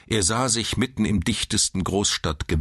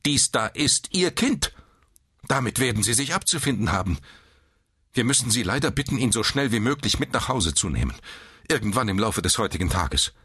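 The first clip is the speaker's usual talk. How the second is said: higher, brisk, strict voice; stern nurse; 7 semitones higher, faster than usual. A narrator's reading. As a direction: lay down the rules briskly and sternly. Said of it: "sagte die Schwester streng". (streng und zügig)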